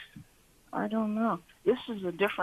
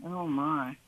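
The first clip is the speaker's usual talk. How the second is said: ordinary talk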